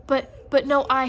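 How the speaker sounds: loud whiny protest. Acted. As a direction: whine it out loud. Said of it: "in a whiny voice".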